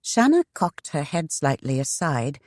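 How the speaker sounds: ordinary delivery, nothing marked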